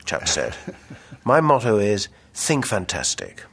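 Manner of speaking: measured literary reading voice, quotes lightly acted; novel reading. A narrator's reading. Author reading from his novel to listeners, steady narration. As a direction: read the passage aloud to the audience.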